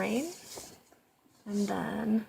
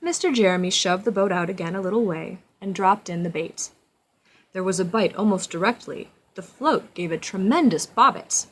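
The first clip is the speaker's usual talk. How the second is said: storybook narration; 6 dB louder than usual. A narrator's reading. Reads storybook aloud to child.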